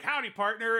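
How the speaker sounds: mock Woody greeting, lightly voiced. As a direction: sing out a friendly cowboy hello. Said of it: "he writes, like".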